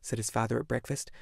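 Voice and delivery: usual speaking voice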